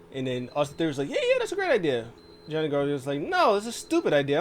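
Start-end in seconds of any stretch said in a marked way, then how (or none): 1.1-2.1 very high, loud enthusiasm; imitated excited outburst; mock eager agreement. Acting out eager sidekick's agreement. 3.2-4.4 high, loud protest; imitated exasperated objection; mock indignant refusal. Acting out wrestler's indignant refusal.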